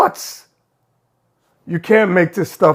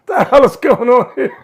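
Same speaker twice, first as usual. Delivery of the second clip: laughing; 3 semitones higher than usual. Real, not acted. Host laughing at the clip.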